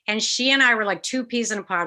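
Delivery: normal talking voice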